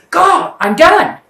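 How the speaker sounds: high, loud exasperated yelling; a shouted, fed-up "I'm done"; fed-up outburst. Retold or imitated. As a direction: blow up, totally done with them. Fed up after endless cheating.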